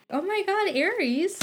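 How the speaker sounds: excited. Real, not acted. Excited reaction to horoscope.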